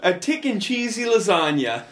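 hammed-up Italian accent, stretched out slowly; a playful fake Italian accent on the lasagna line; mock Italian accent. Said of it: "say that with an Italian accent".

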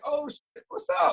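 high-pitched, thrilled mock greeting; excited greeting. Wrestler greeting guys he knew from Instagram, excited high greeting. Acting out greeting friends he met online.

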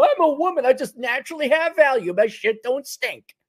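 high mocking entitled voice. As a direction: sneer it smugly.

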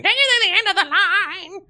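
very high screeching rock wail. Pretend. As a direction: screech it like a hair-metal singer.

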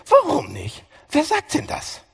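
high, loud indignant protest. Retold or imitated. Defiant churchgoer protesting a rule, indignant and loud. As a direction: bristle like a defiant teenager. Acting defiant self in sermon.

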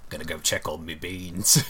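hammy put-on Cockney voice; fake Cockney accent. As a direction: do a comic London Cockney bean lover. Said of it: "Fake Cockney Accents".

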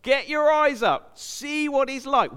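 rousing exhortation. Acted. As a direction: lift their eyes with a rising call. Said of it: "the big agenda of the writer".

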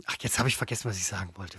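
fading away as the thought slips; trailing off. Real, not acted. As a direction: voice sinks, lost the thought. Forgets what he meant to say.

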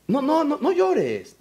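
high-pitched fussy hushing; mock consoling. Someone telling a crying person to stop, mock-soothing. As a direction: imitate a useless comforter, high and insistent.

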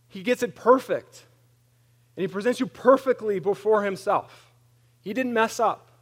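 loud, high preaching build; preaching at full force. Acted. Pastor preaching loud to the church. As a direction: lift the room with it.

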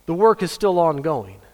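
usual speaking voice

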